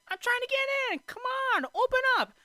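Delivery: very high, squeaky mock yell; hammed-up impatient high voice; Jiminy Cricket. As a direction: demand entry as a frantic little cricket. Jiminy pleads at whale, acted.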